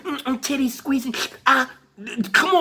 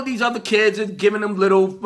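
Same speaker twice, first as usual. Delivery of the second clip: usual speaking voice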